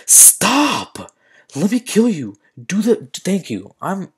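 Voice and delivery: high frustrated yell; getting mad. Real, not acted. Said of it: "I'm getting mad".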